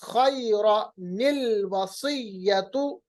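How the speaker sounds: very slow खैरा निल वसीयतु with a linking nil; nun qutni reading. Shown as a demonstration. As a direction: recite खैरा निल वसीयतु slowly as tajweed. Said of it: "दूसरी जबर को नून जेर नि बनाएंगे".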